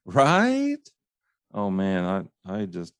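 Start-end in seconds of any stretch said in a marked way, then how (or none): 0.1-0.8 Right? jumping high at the end; mock the questioning Right? with a high rise; upswing tail. Shown as a demonstration. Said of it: "a little upswing".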